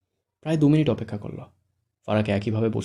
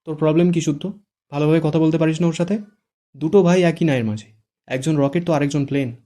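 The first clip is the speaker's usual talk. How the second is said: higher, louder angry reproach; sister-in-law scolding the groom loudly; 5 semitones higher, 7 dB louder than usual. Acted. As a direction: tell him off loudly. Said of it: "রেগে বলে".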